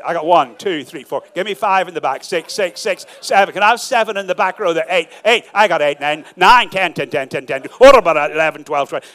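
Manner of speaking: mock auction count. Counting raised hands like auctioneer.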